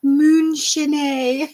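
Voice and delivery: "Moonshine" said as "moon-shin-ay" with a rise; the fancy version "moon-shin-ay" again; fancy pronunciation. Shown as a demonstration. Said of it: "That's what we need to call it".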